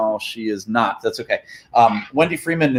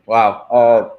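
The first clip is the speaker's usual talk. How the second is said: ordinary talk, nothing marked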